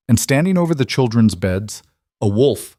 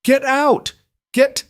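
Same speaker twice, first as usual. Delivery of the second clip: high frantic scream; 3 dB louder, slower than usual. Acted. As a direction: shriek the order to flee. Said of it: "Elira screamed at the kids".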